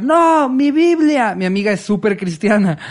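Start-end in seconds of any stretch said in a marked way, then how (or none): 0.0-1.3 high, drawn-out wail of alarm; mock scream. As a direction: imitate a friend's panicked yell, stretched long. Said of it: "Mi amiga empezó a gritar".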